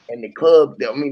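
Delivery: usual speaking voice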